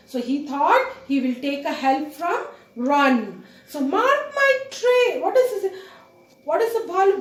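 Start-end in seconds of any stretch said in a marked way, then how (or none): 3.8-5.2 put-on high boyish call; Mowgli calling. Mowgli calling up to the kite, higher. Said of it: "Mowgli says now".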